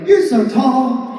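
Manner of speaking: very slow, high, drawn-out admiration; adoring little friend. Pretend. Imaginary friend looks up at him, adoring. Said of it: "look up at me and be like".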